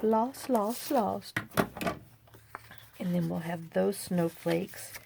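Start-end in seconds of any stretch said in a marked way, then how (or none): 0.0-1.4 singsong lost. Pretend. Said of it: "I'm gonna be lost".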